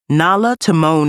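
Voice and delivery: ordinary delivery, nothing marked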